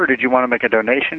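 normal talking voice

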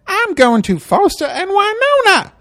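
high, loud, sing-song driver voice; high bus driver voice. Retold or imitated. Retelling the bus driver at the stop, high and breezy. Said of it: "She goes".

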